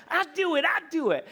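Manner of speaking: put-on high, insistent three-year-old voice; imitating a toddler. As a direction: play the stubborn little kid saying it over and over. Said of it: "He'd just say it over and over".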